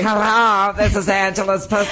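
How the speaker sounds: high, put-on cartoonish phone greeting; silly character voice. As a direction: pitch it up into a silly little voice. Voicing a talking body part.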